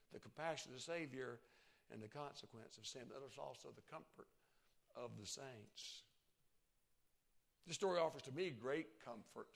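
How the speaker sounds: normal talking voice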